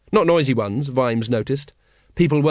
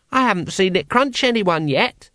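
put-on high child's grumble; complaining child; 6 semitones higher than usual. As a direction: complain like a let-down little child. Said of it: "it complained".

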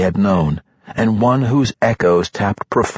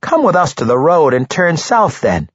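higher, lighter halfling voice; quick eager halfling; 8 semitones higher, 3 dB louder than usual. A narrator's reading. Halfling Regis speaks.

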